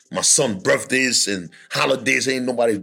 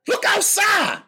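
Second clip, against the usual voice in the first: friend's warning; slower than usual. Retold or imitated. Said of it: "her friend called and said".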